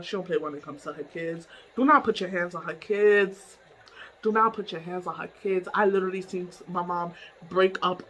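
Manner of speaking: ordinary talk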